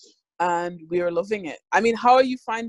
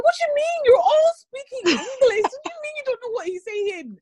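much higher, exasperated squeal of disbelief; mock disbelief. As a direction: act baffled that anyone could miss it. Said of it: "I'm like".

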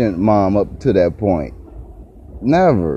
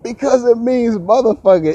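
blunt mom; 13 semitones higher than usual. Retold or imitated. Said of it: "Mom was straight up".

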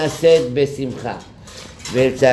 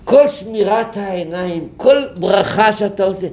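fervent rabbi; 7 semitones higher than usual. Retold or imitated. Rabbi lecturing with fervor.

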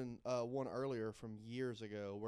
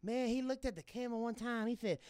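Dusty Rhodes voice; 10 semitones higher than usual. Retold or imitated. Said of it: "You ever heard my Dusty Rhodes?".

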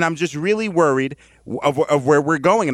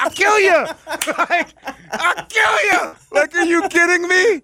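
much higher, shouted mock fury; hammed-up jealous boyfriend yelling; jealous boyfriend rage; 11 semitones higher than usual. Host acting as a boyfriend cheated on in a dream, mock shouting.

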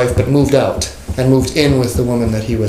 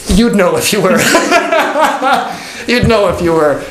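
chuckling through the words; laughing; 7 semitones higher than usual. Real, not acted. Teacher laughing at his class's evasive answer. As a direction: let the laugh spill into the line.

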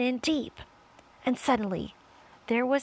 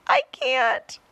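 loud, higher-pitched helpless cry; desperate cry; 5 semitones higher, 8 dB louder than usual. A narrator's reading. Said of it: "cried James".

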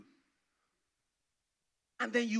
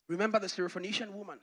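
usual speaking voice

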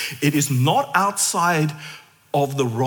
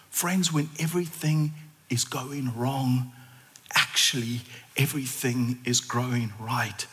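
whispered close; 5 semitones lower, 8 dB quieter than usual. Real, not acted. Preacher closing softly to the congregation, whispered. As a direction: whisper it gently to them. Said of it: "closing whisper".

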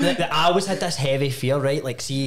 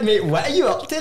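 mock concerned confrontation; 6 semitones higher than usual. Mock shocked challenge to an old friend caught snacking. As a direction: ask it like you just caught a grown man being childish.

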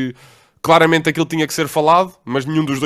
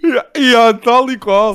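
louder, higher, laughing yes yes; laughing agreement; 7 semitones higher, 6 dB louder, much slower than usual. Real, not acted. Friend laughing along at the relatable story, louder. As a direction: agree while laughing hard.

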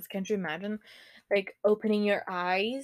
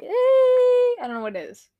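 whiny frustration; 7 dB louder than usual. Real, not acted. Frustrated at unknown word.

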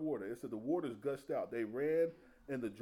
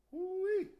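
delighted whoop; 3 dB louder than usual. Real, not acted.

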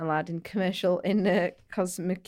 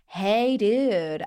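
American stranger; 3 semitones higher, 4 dB louder than usual. Retold or imitated. American stranger greeting two tourists from behind, mock American accent. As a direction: call out like an over-friendly American. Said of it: "we heard this voice go".

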